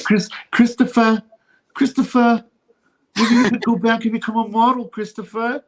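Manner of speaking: high, loud sing-song calling Christopher; mocking sing-song. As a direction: tease him like a nagging mother. Imitating character calling Christopher.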